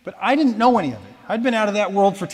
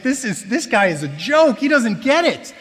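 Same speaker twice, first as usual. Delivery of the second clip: angry rant; 5 semitones higher, 5 dB louder than usual. Retold or imitated. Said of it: "leave the service so angry".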